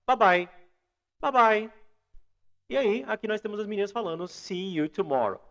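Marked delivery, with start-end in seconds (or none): 0.0-1.8 loud "bye bye" run together as "ba-BAI"; natural quick pronunciation. Shown as a demonstration. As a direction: join "bye bye" with a short "ba" first. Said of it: "Quando você fala rápido".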